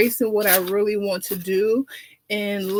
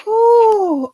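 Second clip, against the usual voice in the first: squealing high, loud ooh; delighted diva squeal; 11 semitones higher, 6 dB louder than usual. Acted. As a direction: squeal it out like the song just hit. Diva reacting to the song.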